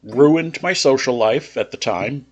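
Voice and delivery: ordinary delivery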